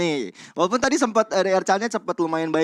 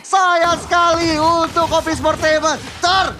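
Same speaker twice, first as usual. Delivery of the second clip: high, loud hype play-by-play; fighting game announcer; 10 semitones higher, 4 dB louder, slower than usual. Acted. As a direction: call the fight at full hype. Caster calling team wipe.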